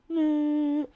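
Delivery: unchanging high monotone beep; high steady tone. Shown as a demonstration. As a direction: hold one high note without bending. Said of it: "it doesn't change".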